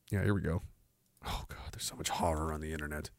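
breathy muttered aside; real dismayed whisper. Real, not acted. Aside while scrolling.

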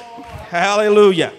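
loud, high fervent preaching; preaching at full force. Acted. Preacher crying out to God before the congregation, fervent. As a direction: build to a cry, ending on Oh God.

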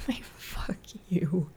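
frustrated whisper; whispered curse. Real, not acted. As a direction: hiss it at yourself. Whispered self-curse after failure.

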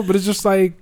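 ordinary talk, nothing marked

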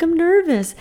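high, loud squeal of nerves; acted-out nervous squeal; excited nerves. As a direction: burst out high and giddy, reenacting the nerves. Acts out her excited nerves.

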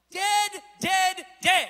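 high, loud, hammered single words; emphatic proclamation. Acted. Preacher driving home that Lazarus is dead, emphatic proclamation. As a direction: punch each word out like a final verdict.

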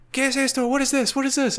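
high, loud, rapid-fire questioning imitation; interrogating guard impression. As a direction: mimic an insistent guard demanding answers. Guard questioning him over found bag.

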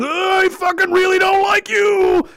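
high, sustained strained shout; hammed-up angry heckler yell; mock yelling. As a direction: holler it like an irate protester. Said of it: "Yelling, going".

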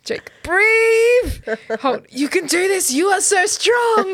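high, loud mock coaching cheer; mock birth coach. Podcaster acting out a sitcom captain coaching a brain baby, loud and high. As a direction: egg him on like a hammed-up midwife.

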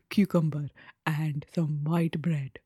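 slow breathy whisper; slow, hushed, husky voice. Pretend. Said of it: "this is how I'm gonna talk".

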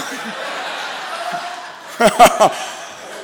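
a long, breathy fit of real laughter; helpless laugh. Real, not acted. Pastor laughing at a profile picture. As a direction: crack up, can't stop.

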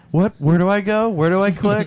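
high, flustered and lost; like someone totally lost online; confused. Retold or imitated. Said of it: "she was just like".

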